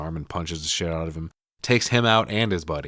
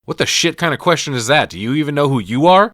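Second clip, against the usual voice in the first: higher, louder mock indignation; aggressive phone voice; 6 semitones higher, 7 dB louder than usual. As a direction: snap back at the caller, acting it out. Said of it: "the voice on the other end of the line is new".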